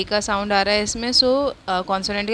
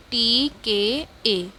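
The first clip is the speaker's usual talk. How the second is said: slow spelling; much slower than usual. Shown as a demonstration. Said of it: "spelling letters for class".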